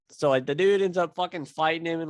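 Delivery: usual speaking voice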